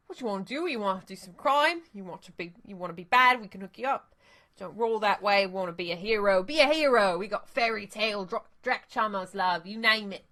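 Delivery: put-on second go at the dealer's pitch, loose Australian accent; vaguely Australian accent. Dealer retrying his pitch to the runners, vaguely Australian accent. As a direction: sell the crimes again in a vaguely Australian accent. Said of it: "in a vaguely Australian accent".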